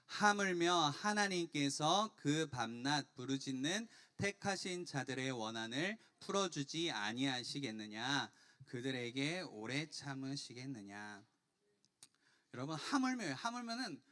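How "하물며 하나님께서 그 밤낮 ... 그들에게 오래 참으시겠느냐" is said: slow, measured group recitation; reading scripture together. Real, not acted. Preacher reading the verse with the congregation, slow and deliberate. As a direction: lead the congregation word by word, slowly. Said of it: "같이 한번 읽어보겠습니다".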